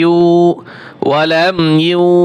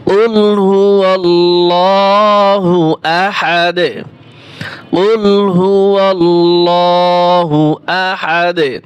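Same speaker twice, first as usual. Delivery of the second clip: slow, drawn-out tajweed recitation; Quran recitation; much slower than usual. Shown as a demonstration. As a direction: chant each word long and even, like tilawat. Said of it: "তা সূরাতুল ইখলাস".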